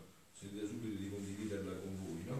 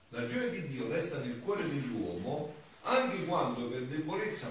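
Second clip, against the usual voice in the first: louder, emphatic sermon delivery; forceful preaching; 4 semitones higher, 8 dB louder than usual. Acted. Priest to the congregation, preaching with force. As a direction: preach it out to the whole church.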